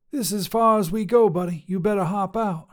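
tough lady trucker. Acted. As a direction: call out like a brash long-haul trucker.

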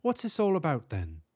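high-starting British question, falling away; distinct British accent, pitch dropping at the end. A narrator's reading. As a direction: ask it as a British professor. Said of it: "speaking with a distinct British accent".

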